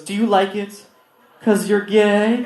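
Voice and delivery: slow, loud teasing question; mock kid needling the teacher; student's taunt.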